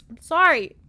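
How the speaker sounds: high loud sorry; loud giggly apology. Real, not acted. Vlogger apologising to viewers for her frog love, loud and high. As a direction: blurt an apology.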